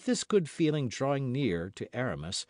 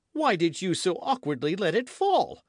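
high, loud counter-accusation; indignant young man's comeback; heated retort; 8 semitones higher, 6 dB louder than usual. A narrator's reading. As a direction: fling the question back, voice raised. D'Artagnan throws the accusation back.

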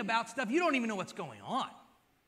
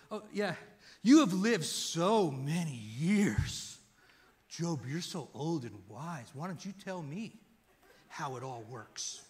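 sarcastic; 4 semitones lower, slower than usual. Retold or imitated. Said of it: "He goes".

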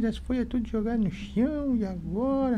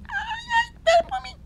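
high tearful voice; crying, high-pitched plea. Acted. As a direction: wail to be waited for. Small ant left behind crying.

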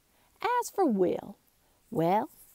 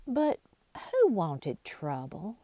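ordinary delivery, nothing marked

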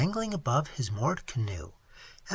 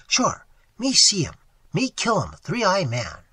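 loud, higher, emphatic broken English; imitated Seminole man; 4 semitones higher, 7 dB louder than usual. Waitress imitates Tigertail claiming the kill, emphatic. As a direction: boast forcefully in his voice. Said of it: "even imitating his voice and gestures".

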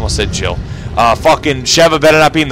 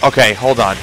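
normal talking voice

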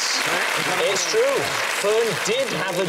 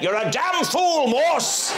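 mock irate outburst, pitched way up; quoted TV outburst; 12 semitones higher, slower than usual. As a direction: imitate an actor's exasperated high yell. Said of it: "Do you remember when he went".

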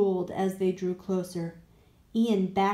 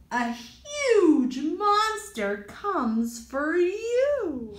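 spooky warning; 4 semitones higher, 4 dB louder, slower than usual. Acted. Ian inventing a scary rule.

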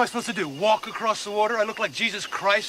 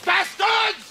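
angry shout; 11 semitones higher, 3 dB louder than usual. Acted. Betrayed man yelling at his captors, furious shout. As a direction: yell it in a fury, high and loud.

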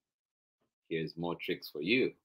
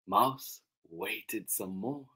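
usual speaking voice

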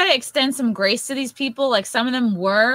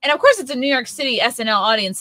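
normal talking voice

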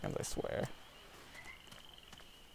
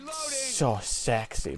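mock swooning; 8 semitones higher, much slower than usual. Teasing coach's deep voice.

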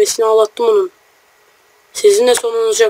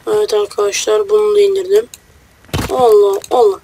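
ordinary talk

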